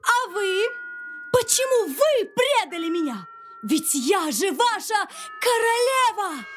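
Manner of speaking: very high, outraged queen; a queen crying out at betrayal; angry queen shouting. Acted. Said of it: "и закричала".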